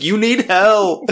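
high, loud, slow shout. Retold or imitated. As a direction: yell it at them urgently. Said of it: "They're like".

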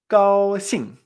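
GAOXING, GAO alto y fuerte, XING cae; sostén GAO y deja caer XING de golpe; tono uno cuatro. Shown as a demonstration. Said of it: "Uno cuatro". («gaoxing»: alto plano y luego descendente)

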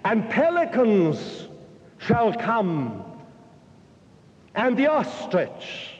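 put-on high, slow prophet's cry in a Hebrew accent. Preacher voicing a Hebrew prophet crying out in a Hebrew accent. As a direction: call it out like an old Hebrew prophet in a square. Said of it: "in a somewhat foreign accent, a Hebrew accent".

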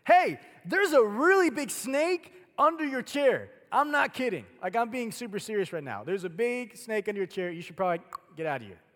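loud, high shouted alarm; urgent warning. Shown as a demonstration. Preacher showing how Scripture speaks, urgent shouted warning. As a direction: yell it like the danger is right there. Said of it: "No, the Bible speaks like this".

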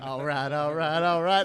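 mimicking Matthew McConaughey's Dazed and Confused catchphrase; Matthew McConaughey impression. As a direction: roll the all rights out like McConaughey. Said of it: "That's what you just sounded like".

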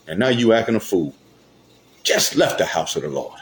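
ordinary talk

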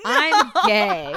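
high, loud laughing quote. Retold or imitated. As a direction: burst out laughing on it. Quoting coming out, laughing.

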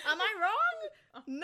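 high, loud laugh into a slow question. Real, not acted. Host laughing with her cohost, loud laugh. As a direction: laugh through am I wrong.

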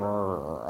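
a low straining grunt; low effortful growl; low strained growl. Retold or imitated. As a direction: grind it out low like pushing hard. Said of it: "it was like just going".

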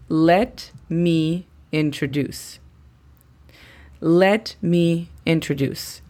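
"Let me introduce" spoken slowly, word by word; slow model phrase. Shown as a demonstration. Teacher introduces the second phrase to learners, slow model phrase. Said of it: "La segunda frase sería".

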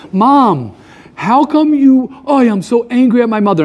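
high-pitched complaint about Mom; mock exasperated. Grown child angry at mother, mock exasperated. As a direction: play up the indignation at a parent.

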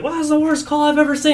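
high-pitched, hammed-up outrage; mock angry cussing-out. Batter cussing out the umpire, in high mock anger. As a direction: protest the call in a high mock fury. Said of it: "He starts cussing out this guy".

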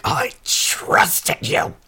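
slow, high, squeaky-thin wounded cry; hammed-up betrayal. Quint turns to Doggy Daddy, melodramatic betrayed wail. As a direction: stretch it out as if deeply wounded.

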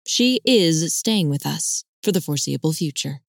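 "is" set apart with clear emphasis; stress on "is". A narrator's reading. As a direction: put firm emphasis on the word "is". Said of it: "emphasize the word "is".

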